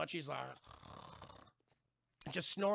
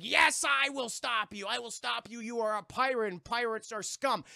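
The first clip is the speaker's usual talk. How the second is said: higher, declamatory earnest hero; mock heroic defiance; 4 semitones higher, 5 dB louder than usual. Young blacksmith blocks the pirate's exit, mock heroic defiance. Acts Will defying the pirate.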